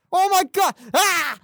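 loud, high cry of disbelief; outraged outburst. Real, not acted. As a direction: burst out in real exasperation. Outraged at friend's dislike of films.

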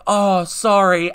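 high, loud, drawn-out mock apology; awkward apology. Apologising to the crush after biting an ear, awkwardly. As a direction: stretch out an embarrassed sorry. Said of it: "then I was so awkward".

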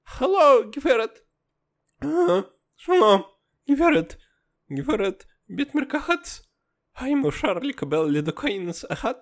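put-on high, heavy Russian accent in Hebrew. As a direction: kids putting on thick Russian accent in Hebrew. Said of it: "בעברית עם מבטא רוסית נורא נורא כבד".

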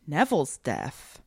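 ordinary talk

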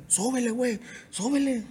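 half-whispered urging to turn it up; mock plea. Singer imitating himself begging the engineer to turn up. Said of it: "yo le decía al ingeniero".

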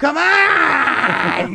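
put-on high, whiny cry of a bratty kid; whining kid. S0 mocking kids who want his phone, whiny wail. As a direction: wail it like a spoiled child.

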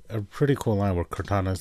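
normal talking voice

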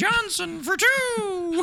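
very high squeaky bird voice, slow. Pretend. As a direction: squeak it like a bird pretending to be human. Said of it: "bird trying to walk into a cafe".

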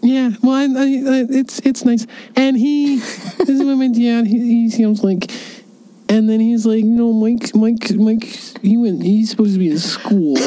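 high put-on grown-up voice; fake dad voice. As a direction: keep the phony dad going. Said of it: "This is a great impression".

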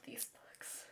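slow breathless whisper of fondness. Real, not acted. Fan confides her love of the books, whispered. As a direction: hush it out slowly, like a confession. Fond aside about favorite books.

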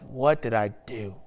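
lightly acted, hushed self-reproach; mildly regretful self-questioning. David asking himself about his sin, mildly regretful. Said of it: "he asked himself".

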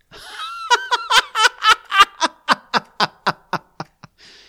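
a long, loud, high burst of laughter. A narrator's reading. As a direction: explode into laughter. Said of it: "He bursts out laughing".

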